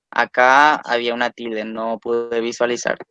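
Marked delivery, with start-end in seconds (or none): none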